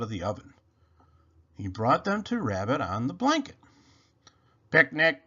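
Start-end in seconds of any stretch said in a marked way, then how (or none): 4.7-5.2 monotone robot voice, slightly higher, louder. A narrator's reading. Robot answers Rabbit at the picnic, monotone robot voice. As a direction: say it evenly as a robot. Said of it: "said Robot".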